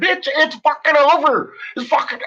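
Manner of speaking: high-pitched screaming fury. Retold or imitated. Yelling at the senator's sister on the phone, high furious scream. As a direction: scream it at her, high and cutting. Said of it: "I'm screaming".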